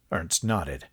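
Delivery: ordinary delivery, nothing marked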